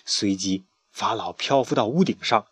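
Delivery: ordinary delivery, nothing marked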